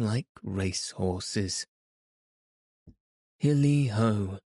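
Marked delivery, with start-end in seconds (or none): 3.4-4.4 cheerful cry. Acted. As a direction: sing out to the room. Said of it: "cried old Fezziwig".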